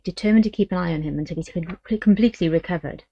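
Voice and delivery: usual speaking voice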